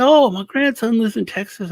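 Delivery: put-on high, cheery old-man brag; imitating proud grandpa. Grandpa tells his own grandson about him, high cheery voice. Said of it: "He said".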